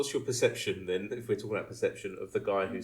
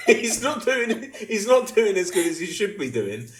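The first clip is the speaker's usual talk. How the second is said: high, loud, hammed-up mum; comic mum voice, pitched way up; mock mum; 11 semitones higher, 9 dB louder than usual. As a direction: plead a pretend mum's case while fighting laughter. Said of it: "imagine his mum said".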